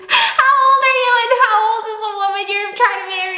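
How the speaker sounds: high incredulous question; imagined neighbor judging the fiance, incredulous; shocked disbelief. Retold or imitated. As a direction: ask it aghast at the age gap. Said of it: "Looking at my fiance as if".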